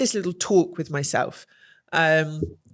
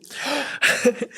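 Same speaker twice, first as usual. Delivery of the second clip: shocked gasp; 6 semitones higher than usual. Real, not acted. After tripping over a table.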